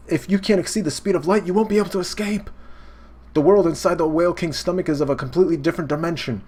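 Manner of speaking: high, fast and urgent; panicked high-pitched explanation; alarmed warning. Retold or imitated. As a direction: rattle off the danger in a rush. Ally warns Neo in panic.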